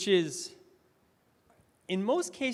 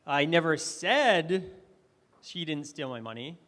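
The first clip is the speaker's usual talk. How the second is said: said punched louder, slow; stress on said; 4 dB louder than usual. Shown as a demonstration. Speaker demoing stress to the audience, stress on said. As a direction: hit said hard and slow. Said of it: "I emphasized different words".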